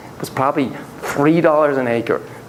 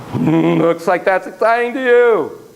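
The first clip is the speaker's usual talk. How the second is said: reflective response; slower than usual. Shown as a demonstration. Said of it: "Always respond to".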